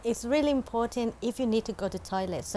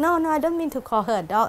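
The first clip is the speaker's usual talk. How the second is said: quick, higher, louder retold protest; flustered denial; 4 semitones higher, 5 dB louder, faster than usual. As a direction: hurry to clear up the misunderstanding. Retold flustered son-in-law defends himself.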